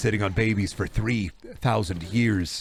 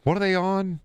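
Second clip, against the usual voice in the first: high incredulous; 8 semitones higher than usual. Real, not acted. Reacting to weird video.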